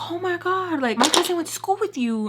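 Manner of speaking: high, eager mock "oh my God"; excited discovery. Woman telling the influencer about a shared connection, excited.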